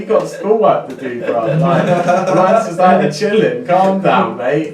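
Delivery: high, amused, dismissive mockery; mocking scorn. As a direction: laugh off the insult with scorn. Mocking the hat-insulting kid.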